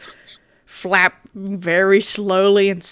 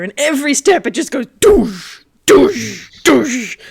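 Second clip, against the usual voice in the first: heavy footstep thuds; 4 semitones higher, 4 dB louder than usual. Acted. Bird footsteps.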